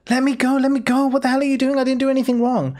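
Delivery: high, pleading goblin cry. Acted. Goblin begging the bugbear to let go, high and pleading. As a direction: cry out as a small scared goblin. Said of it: "she cries out".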